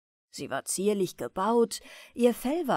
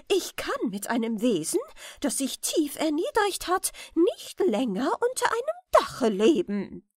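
raised, indignant refusal; angry queen; 5 semitones higher, 3 dB louder than usual. A narrator's reading. Queen refuses to live with the rat, angry and raised. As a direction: spit out the verdict, higher and louder. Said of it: "rief die Königin zornig".

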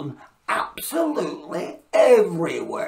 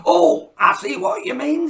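elf voice; 10 semitones higher, 7 dB louder than usual. Acted. Elf reacting to the noise.

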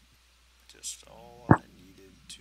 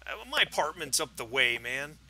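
lightly voiced tipsy neighbor, pitched up; defensive drunk; 2 semitones higher, 10 dB louder than usual. Drunk neighbor explains himself to detectives, mildly defensive. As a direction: protest with a touch of drunken defensiveness.

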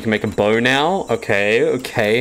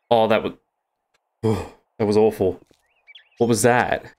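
normal talking voice

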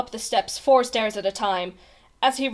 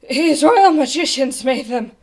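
loud, breathless explanation; panting; 3 semitones higher, 7 dB louder than usual. A narrator's reading. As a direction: blurt it out while short of breath. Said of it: "panted Bartholomew".

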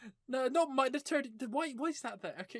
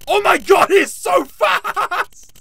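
loud laughing panic, pitch up; panicked laughing shout; 5 semitones higher, 8 dB louder than usual. Real, not acted. Player fleeing the fast teacher, panicked laughing shout. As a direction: shout through laughter as he's chased.

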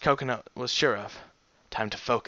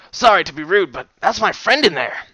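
explorer voice; 5 semitones higher, 7 dB louder than usual. Acted. Worried explorer voice.

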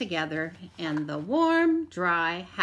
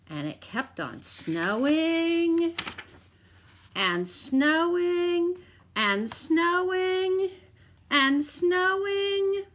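slow, rising higher and louder with each snowing; building repetition; 8 semitones higher, 4 dB louder, much slower than usual. A narrator's reading. Narrator building the endless snow, slow and rising.

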